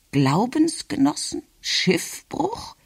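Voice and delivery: high, questioning echoes; baffled grandmother. A narrator's reading. Grandmother repeats words from the speech, baffled and high.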